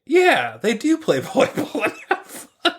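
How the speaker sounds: high-pitched, gullible mock excitement; eager agreement. Jerry falling for the cat's Florida pitch, eagerly agreeing. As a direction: imitate someone instantly won over, voice jumping up.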